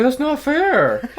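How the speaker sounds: very high, loud, drawn-out mock whine; suddenly sobered-up protest. Boyfriend cut off from his Xanax, loud mock protest. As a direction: wail it like a cheated kid. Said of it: "sobered up immediately".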